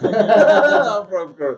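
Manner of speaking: laughing through the words. Real, not acted. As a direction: breaks into laughter mid-word. Laughing while telling own origin.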